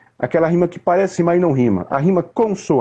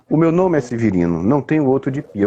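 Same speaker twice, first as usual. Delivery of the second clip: unhurried introduction. Shown as a demonstration. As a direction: let it walk, a man calmly presenting himself. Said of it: "o ritmo aqui é devagar". (ritmo pausado, se apresentando)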